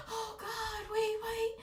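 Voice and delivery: high panicked gasping pleas. Retold or imitated. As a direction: gasp and plead in panic. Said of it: "And I was panicking".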